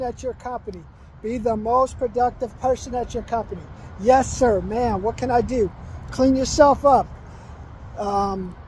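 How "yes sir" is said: usual speaking voice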